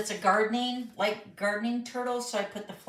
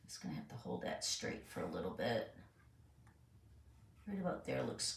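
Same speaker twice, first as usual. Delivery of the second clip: whispered under breath; 10 semitones lower, 16 dB quieter than usual. Real, not acted. Straining to hold card straight.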